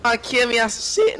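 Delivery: usual speaking voice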